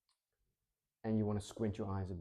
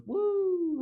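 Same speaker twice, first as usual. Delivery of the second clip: long slow whoop soaring way higher and louder; roller coaster woo; 8 dB louder than usual. Shown as a demonstration. Speaker imitating a roller coaster climb, long excited whoop. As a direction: ride the climb with a slow whoo. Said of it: "a roller coaster".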